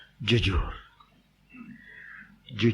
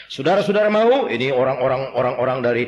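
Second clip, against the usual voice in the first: ordinary talk, nothing marked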